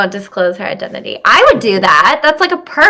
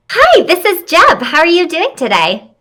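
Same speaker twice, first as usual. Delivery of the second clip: high, bright customer service greeting; phone voice; 9 semitones higher than usual. Pretend. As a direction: answer like a polite receptionist. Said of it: "That's my phone voice".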